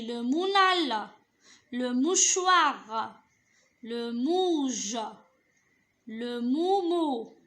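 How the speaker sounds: each made-up or real word read slowly, "mou" held before its ending; joining syllables. Shown as a demonstration. Teacher trying syllable combinations, slow deliberate reading from "mounal" to "moumou". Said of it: "Je relis les syllabes".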